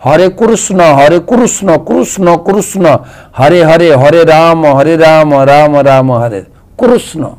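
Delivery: কৃষ্ণ said kurusno in an Odia accent, rounded o at the end; Odia pronunciation. Shown as a demonstration. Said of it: "উড়িষ্যার লোকেরা কীভাবে উচ্চারণ করেন জানেন?".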